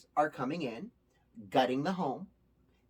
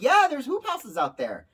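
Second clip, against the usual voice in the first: insistent neighbor; 9 semitones higher, 8 dB louder than usual. Retold or imitated. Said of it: "He's like".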